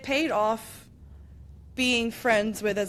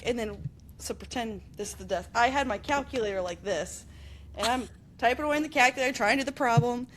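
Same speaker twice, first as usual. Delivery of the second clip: ordinary talk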